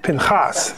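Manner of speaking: "Pinhas" with a throaty ch for the h; guttural ch. Shown as a demonstration. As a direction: say "Pinhas" as pin-KHAHS. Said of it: "It may be a ch".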